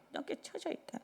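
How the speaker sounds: high, soft unvoiced whisper; drooping whisper. Pastor showing drooping kids, in a whisper. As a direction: breathe it out like a wilted child. Preacher acting out drooping child.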